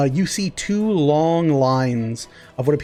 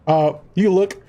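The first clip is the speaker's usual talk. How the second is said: ordinary delivery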